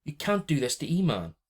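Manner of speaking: desperate plea. A narrator's reading. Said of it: "I said desperately".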